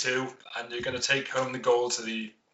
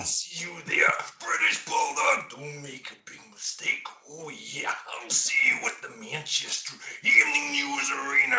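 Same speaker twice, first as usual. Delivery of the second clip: Randy Savage impression; 4 semitones higher than usual. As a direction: snarl it like Macho Man Randy Savage, swinging between yell and hiss. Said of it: "I was convinced that was Randy Savage".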